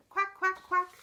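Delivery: high, loud little quacks; happy duckling quack. Acted. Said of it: "When I'm feeling happy, I say".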